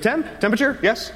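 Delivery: waiter's high repeat. Retold or imitated. Imitating the waiter pressing him for a temperature, high and insistent.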